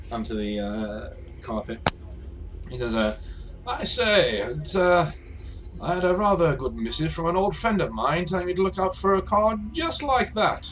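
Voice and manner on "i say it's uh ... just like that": high, lilting toff recounting his errand; jolly airship gentleman. Acted. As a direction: swoop up high like an old-fashioned gentleman. Game master plays airship gentleman.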